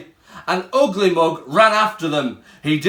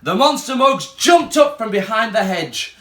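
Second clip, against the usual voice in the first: ordinary delivery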